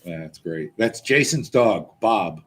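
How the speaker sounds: usual speaking voice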